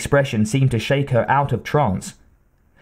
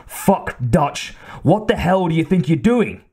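sharp breathy curse, then an outraged higher bawl; bawled outrage; 5 semitones higher than usual. A narrator's reading. Soldier bawling at Dutch over the freed prisoner, outraged. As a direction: bawl it out in disbelief. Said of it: "he bawled".

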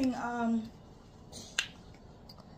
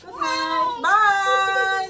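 cheery goodbye; 7 semitones higher, 9 dB louder, much slower than usual. Real, not acted. Calling goodbye to viewers.

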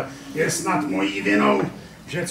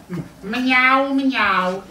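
eager meowing mňau mňau, a bit higher; the puppy finally copying the cat; proud little meow; 8 semitones higher, 3 dB louder, much slower than usual. Acted. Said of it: "vykřiknu m jako míca".

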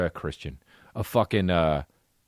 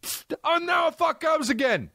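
mock yelled refusal; 13 semitones higher than usual. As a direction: shout the refusal like a spoiled star. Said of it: "Be like".

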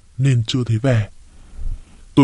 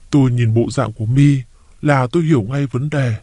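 ordinary delivery

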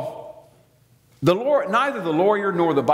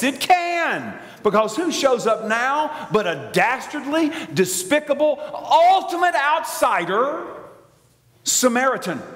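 mock dramatic build-up; 7 semitones higher than usual.

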